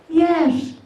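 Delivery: high phone voice, pitched well up. Acted. Comic answering whether the parents are home, high-pitched. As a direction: answer in a voice that has gone up high. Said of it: "my voice goes up high sometimes".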